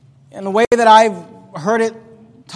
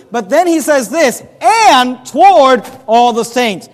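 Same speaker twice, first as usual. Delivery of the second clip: loud, higher-pitched sermon delivery; emphatic preaching; 5 semitones higher, 6 dB louder than usual. Acted. Preacher tells congregation what Paul says about Philemon, emphatic preaching. Preaches a verse at full force.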